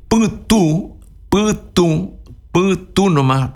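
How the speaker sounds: Putú said high, with a nasal final ú; nasal u demo. Shown as a demonstration. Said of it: "la U nasal". (putú con la ú nasal, en tono agudo)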